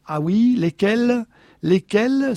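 high, loud, slow demand; insist, getting hotter; flaring up. A narrator's reading. Husband demanding details of the dream, flaring up. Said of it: "s'emporta-t-il".

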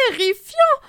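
shrieked word. Acted. She-wolf with glasses chiming in, high. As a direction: squeal it out. Wolves compete in outrage.

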